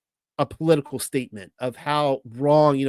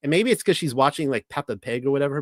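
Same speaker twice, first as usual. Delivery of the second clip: normal talking voice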